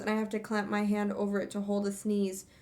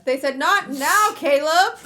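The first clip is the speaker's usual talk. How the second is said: laughing; 9 semitones higher, 12 dB louder, slower than usual. Real, not acted. Laughing retelling a shouted quote.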